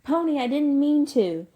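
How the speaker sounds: high, loud desperate cry; regretful yell at the top of the voice; screaming. Acted. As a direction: scream it after someone running off. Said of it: "Derry screamed".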